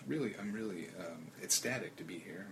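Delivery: soft, quiet radio guest; calm hushed public radio voice; public radio host. Pretend. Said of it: "After your best public radio voice".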